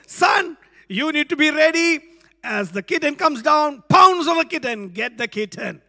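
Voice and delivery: put-on rally the boy to be ready; father coaching son to catch kitten, loud and eager; father's excited instructions. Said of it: "and said".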